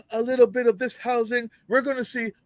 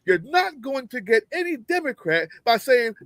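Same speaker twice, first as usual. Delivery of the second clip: ordinary talk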